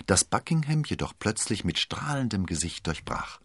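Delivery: plain reading voice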